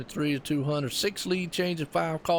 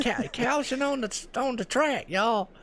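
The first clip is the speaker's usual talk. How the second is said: laughing Southern drawl; 9 semitones higher than usual. Retold or imitated. Spotters joking about their own accents, a laughing Southern drawl. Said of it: "our accents talk like that".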